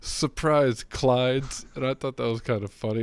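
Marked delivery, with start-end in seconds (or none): 0.0-1.6 gleeful Lobo taunt. Retold or imitated. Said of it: "When he says".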